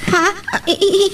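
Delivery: flustered giggling stammer; nervous giggle through the stammering; nervous laugh. Acted.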